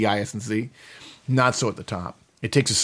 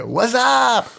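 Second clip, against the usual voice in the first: higher, louder "What's up?" repeated for emphasis; louder slang greeting; 11 semitones higher, 4 dB louder than usual. Retold or imitated. Repeating it for the puzzled cohost, louder and slangy. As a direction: belt "What's up?" like a buddy across a room. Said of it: "What's that? What?".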